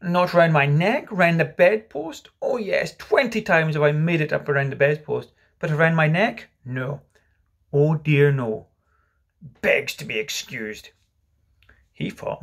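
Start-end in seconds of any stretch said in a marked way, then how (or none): 0.0-10.9 dangerously sarcastic. Acted. Father complains of the tie round his neck, sarcastic. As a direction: heap scorn on it, phrase by phrase. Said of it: "He became dangerously sarcastic".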